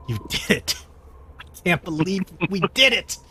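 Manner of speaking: a giddy, grateful cheer of victory; overjoyed Gaffna. Acted. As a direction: Gaffna beside herself with happiness over the win. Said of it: "She's so happy".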